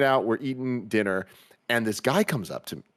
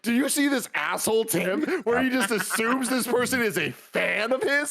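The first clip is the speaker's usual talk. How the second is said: mocking friends; 10 semitones higher than usual. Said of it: "They're all making fun of me".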